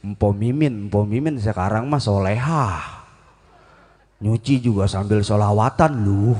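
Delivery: imitated neighbour's low jibe; gossiping neighbour. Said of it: "Ntar apa kata tetangga?".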